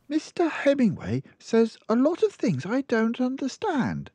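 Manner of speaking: put-on child explaining her mother's habits; small girl. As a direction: speak like a matter-of-fact little girl. Girl talks about book.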